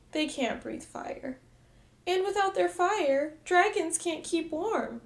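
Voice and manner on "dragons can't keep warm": usual speaking voice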